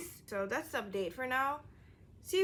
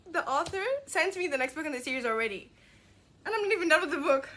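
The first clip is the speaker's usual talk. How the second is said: higher, fast excited gush; squealing with thrilled disbelief to viewers; 7 semitones higher, 4 dB louder than usual. Real, not acted. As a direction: rush it out, thrilled. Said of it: "crying because I felt seen".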